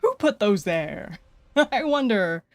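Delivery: slow, teasing wonder; sly mock innocence. Streamer feigning ignorance about dropped items, slow and coy.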